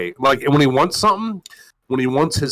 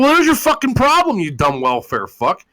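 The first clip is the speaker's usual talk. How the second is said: mocking retort; 10 semitones higher than usual. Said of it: "I'm like".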